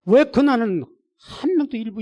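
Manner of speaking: high, loud complaint to God; protesting prayer. Retold or imitated. Pastor asking God about empty Sunday, protesting loudly. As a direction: demand an answer from heaven.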